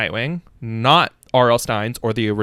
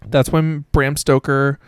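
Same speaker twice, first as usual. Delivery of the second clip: ordinary talk, nothing marked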